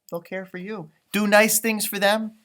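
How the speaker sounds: ordinary delivery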